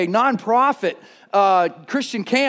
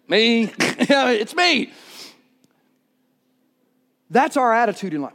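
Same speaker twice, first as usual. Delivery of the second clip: usual speaking voice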